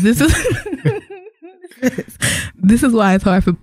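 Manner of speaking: reading through real giggles; breaking into laughter. Real, not acted. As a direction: try to read while fighting laughter. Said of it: "I'm not gonna be able to get through it".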